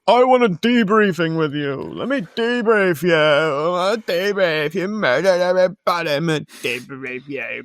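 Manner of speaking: put-on pompous official, words stretched out; mock deputy chief. Podcaster mimicking the deputy chief demanding a debriefing, mock officious voice. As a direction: mockingly drag it out, sinking into a garble. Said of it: "old fucking Deputy Chief Knobhead is like".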